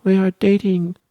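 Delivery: put-on high, slow gushing girl; mock girly gush. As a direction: announce it like a proud little girl. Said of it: "she's like".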